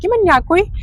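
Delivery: high, rising call „Има ли някой?“. Shown as a demonstration. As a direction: call „Има ли някой?“ toward the door, pitch up. Calling out at the door.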